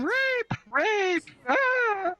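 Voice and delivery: very high imitation shriek without the volume; held-back falsetto scream; mock scream. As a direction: mimic her screaming, thin it into a high held cry. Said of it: "She's screaming".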